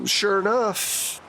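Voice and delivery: usual speaking voice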